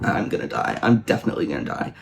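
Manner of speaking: low-pitched, doomed resignation; mock despair. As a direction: drop the voice low, hammed-up hopelessness. Acting Victor despairing in the cold.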